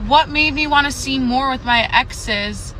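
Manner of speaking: usual speaking voice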